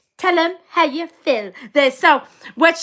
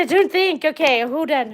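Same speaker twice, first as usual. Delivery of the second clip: ordinary talk, nothing marked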